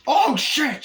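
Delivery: very high, loud mock yelp; shocked cry. Driver witnessing the shooting, shocked cry.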